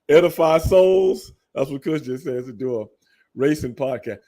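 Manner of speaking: ordinary talk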